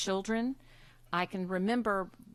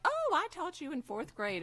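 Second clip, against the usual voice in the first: mock cheery teacher; 9 semitones higher than usual. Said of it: "they'll be like".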